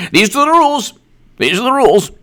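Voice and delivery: very high-pitched mocking voice; mock outburst. Commentator parodying defenders of the rules, high mock insistence.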